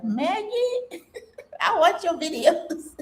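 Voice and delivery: high, laughing fan squeal; excited fan. Retold or imitated. A fan recognizing her in a restaurant, high excited squeal. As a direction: gush like a thrilled viewer. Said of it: "she's like".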